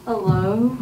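ordinary talk

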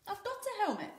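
put-on high boyish voice, offhand; shrugging Knut. A narrator's reading. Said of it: "Knut replied with a shrug".

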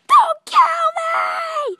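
high, slow, shouted squeaky plea; panicked spider voice. Pretend. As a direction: cry out like a terrified spider. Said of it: "It was like".

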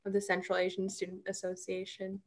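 normal talking voice

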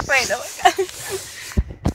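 slow, stunned gasp of real shock; real astonished oh my god. Real, not acted. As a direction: let the shock catch your breath. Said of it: "I'm having a shock right now".

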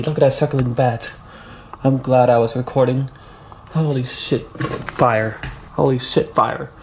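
ordinary talk

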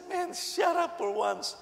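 fed-up plea to a noisy leader; exasperated retort. Retold or imitated. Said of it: "I said".

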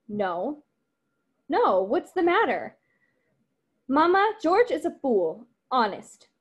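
lively read-aloud of the script's dialogue, voices lightly acted; reading a play aloud. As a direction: read the scene to the class, giving the lines some life. Reading Mama and Beneatha.

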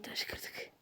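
hushed happy whisper. Real, not acted. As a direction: whisper it with glee. Quiet victory while sneaking.

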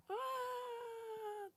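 high, strange drawn-out mock yell; imitated raider yell. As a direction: mimic a weird distant shout. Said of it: "Bakın yine geliyor etraftan". (tuhaf, tiz taklit bağırma)

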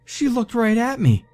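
very high hysterical voice. A narrator's reading. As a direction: near tears with fear. Hysterical friend describing voice.

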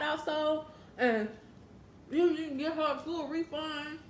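talking with mouth full; words muffled by food; chewing food. Real, not acted. Seen 2: puts food in mouth at 1.4s, then talks while chewing.

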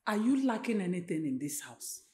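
low, soft probing question; hushed motherly query; quiet questioning. Acted. Mother begins questioning her upset daughter.

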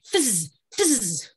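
buzzing like a bee through the teeth. Shown as a demonstration. Voice coach showing the group a bee buzz. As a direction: show the class a buzzing bee sound. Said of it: "I want you to make this sound for me".

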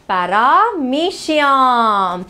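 "paramecium" stretched very slowly, syllable by syllable; drawn-out prompting. Shown as a demonstration. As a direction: sing out "paramecium" slowly for the class. Teacher cues class to complete term.

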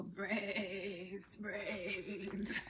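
soft, low undead moaning; zombie moan. Acted. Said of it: "So how do the zombies walk? They go".

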